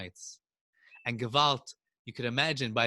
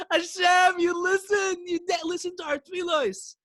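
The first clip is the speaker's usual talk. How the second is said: joyful shout. A narrator's reading. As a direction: shout thanks to heaven, laughing. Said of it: "they started laughing".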